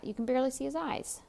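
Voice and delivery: ordinary delivery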